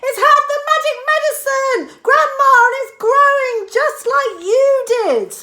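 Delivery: high, loud excited shout; high loud boy, thrilled; George shouting. A narrator's reading. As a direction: point and shout to Grandma.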